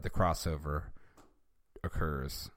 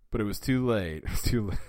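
plain reading voice

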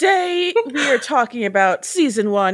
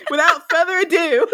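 higher, put-on British accent; 7 semitones higher, faster than usual. Host redoing the intro on request, British accent. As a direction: announce it in mock British. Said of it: "Say it in a British accent".

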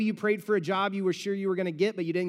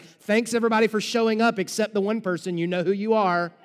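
passive aggressive; 4 dB louder than usual. Pretend. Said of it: "get on Facebook and be like".

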